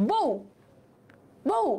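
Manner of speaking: "বউ" closed on a half-sounded উ, pause between; hasanta ending. Shown as a demonstration. Teacher showing the hasanta, "বউ" stopped short. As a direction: clip the final উ of "বউ" each time. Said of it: "শেষে একটা হস আছে".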